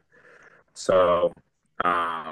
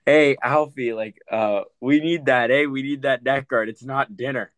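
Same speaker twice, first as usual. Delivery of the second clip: higher-pitched mock holler; retold yell; 7 semitones higher than usual. Hockey player chirping a rival from across the bench, yelling. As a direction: call out in an imitated opponent's yell. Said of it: "McHugh yells over at Alfano".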